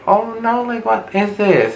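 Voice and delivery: high, appalled disbelief; horrified squeak. Retold or imitated. Meeting the sleazy rooms, high horrified squeak. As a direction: recoil in shocked disbelief.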